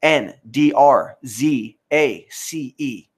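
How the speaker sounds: slow letter-by-letter spelling; spelled out. Shown as a demonstration. Spelling a channel name for viewers, slow and deliberate. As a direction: spell it out slowly for the chat. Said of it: "Is how you ca-, spell it".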